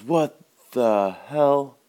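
high, loud, very slow exclamation; disgusted outrage. Acted. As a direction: stretch out each word in acted disbelief. Customer spits out apple, outraged.

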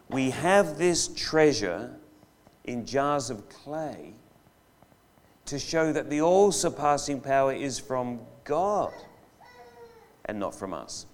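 slow, deliberate reread; slow scripture reading. Acted. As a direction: lay out each phrase slowly. Rereading the verse.

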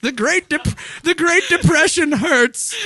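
put-on very high, drawn-out old-timer wail; hammed-up elderly lament; feeble old person. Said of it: "sad old feeble couple".

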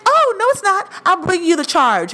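high, chirpy hostess imitation; helpful customer-service sing-out; eager hostess. Imitates eager hostess offering upgrade.

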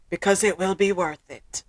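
normal talking voice

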